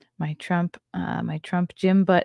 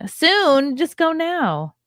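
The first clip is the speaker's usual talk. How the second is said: urging; 10 semitones higher, 5 dB louder, much slower than usual. Real, not acted. Urging a chat viewer to vote.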